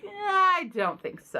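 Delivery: high, dismissive "Eh"; mock-dismissive reaction to a synopsis. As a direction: brush it off with a high quoted shrug. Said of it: "I'm like".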